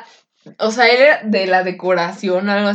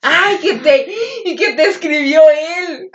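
higher, louder giddy stammer; flustered gushing; 6 semitones higher, 3 dB louder than usual. Retold or imitated. Teen girl caught chatting with her crush, higher flustered gush. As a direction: gush it out in embarrassed excitement. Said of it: "Y yo".

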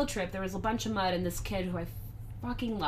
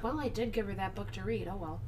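resigned aside; 11 dB quieter than usual. Retold or imitated. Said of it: "But I was like".

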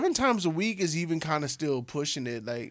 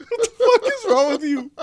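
laughing; 7 dB louder than usual. Real, not acted. Cracking up at cohost.

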